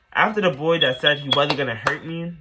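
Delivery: ordinary delivery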